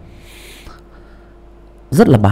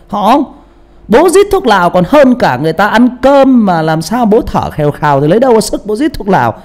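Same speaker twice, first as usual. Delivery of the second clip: high, excited rebuttal; animated protest; 10 semitones higher, 4 dB louder than usual. Real, not acted. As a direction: argue back, fired up and high. Tự phản bác hăng hái. (phản bác hăng hái, giọng cao)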